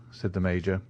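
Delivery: ordinary delivery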